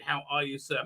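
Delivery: ordinary delivery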